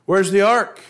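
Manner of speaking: shouting demand. Acted. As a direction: call out for the missing ark. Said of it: "he shouted".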